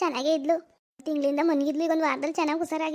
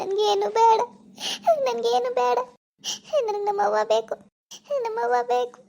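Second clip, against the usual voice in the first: tearful, higher, crying; crying refusal; 5 semitones higher than usual. Acted. Orphaned girl refusing tea, crying for her mother. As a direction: refuse through tears, voice climbing.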